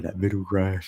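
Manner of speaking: normal talking voice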